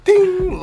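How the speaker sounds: high, ringing imitated ding; sudden loud, high-pitched clang sound.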